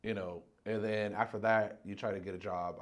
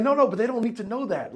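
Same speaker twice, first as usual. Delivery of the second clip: high, loud brushing-off voice; louder mock shady boss; dismissive boss; 11 semitones higher, 10 dB louder than usual. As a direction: wave away the worry. Voicing shady boss explaining.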